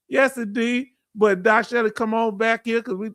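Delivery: ordinary delivery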